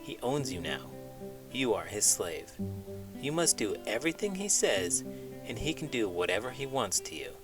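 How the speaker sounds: ordinary delivery, nothing marked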